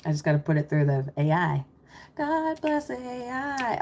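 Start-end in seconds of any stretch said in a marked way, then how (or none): 2.2-3.8 mock reverent. Mock blessing of the AI.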